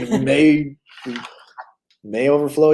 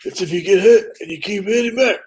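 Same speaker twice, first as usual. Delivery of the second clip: a hint of a Philly Rocky voice, higher; mock Rocky quote in Philly accent; Philadelphia accent; 7 semitones higher than usual. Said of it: "that's a freaking Philadelphia accent".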